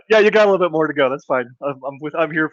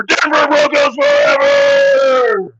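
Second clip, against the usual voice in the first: high, slow, shouted wrestler war cry; stretched hammed-up shout; battle cry; 12 semitones higher, 4 dB louder, much slower than usual. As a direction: scream it out to the crowd. Promo climax battle cry.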